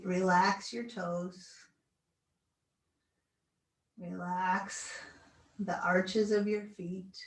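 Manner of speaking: slow, soothing guided relaxation. Acted. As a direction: talk them gently into stillness. Leads final relaxation.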